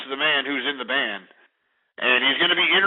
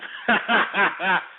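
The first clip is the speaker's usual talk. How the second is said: laugh; 5 semitones higher than usual. Real, not acted. Laughs at fight clip.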